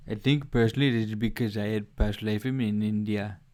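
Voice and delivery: loud, showy Indian accent; caricatured Indian accent. Shown as a demonstration. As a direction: put on a projected Indian accent. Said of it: "base my Indian accent off of the lemur".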